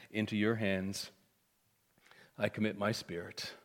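low, soft quoted prayer; hushed surrender. Retold or imitated. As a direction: give it up gently, quietly. Jesus' dying words, quoted reverently.

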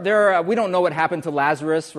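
normal talking voice

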